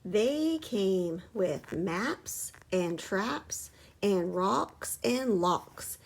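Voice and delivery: slow spooky storytelling; slow ominous telling. Acted. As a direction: draw it out menacingly. Menacing hunters description.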